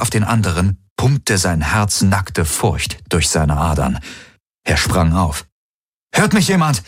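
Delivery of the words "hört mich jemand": high, urgent call into the comm; alarmed high-pitched call. A narrator's reading. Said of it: "rief er in sein Komm".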